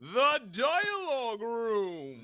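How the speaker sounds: booming slow announcer, stretching the words. Acted. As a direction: present it like a TV show host. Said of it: "This is the worst fucking thing".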